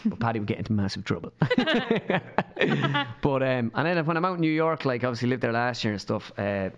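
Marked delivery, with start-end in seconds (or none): 1.4-3.2 high bubbling laugh; real chuckling, pitched up; amused laugh. Real, not acted. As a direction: laugh at your own aside. Laughing at own remark.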